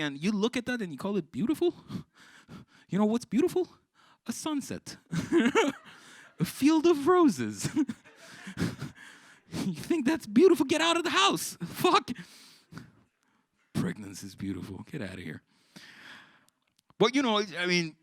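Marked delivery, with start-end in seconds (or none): 2.9-12.1 rising, indignant high-pitched tirade; high pitched rant. Real, not acted. Comic ranting at the audience about pregnancy. 13.7-15.4 mock scoff. Scoffing repeat of the cliché.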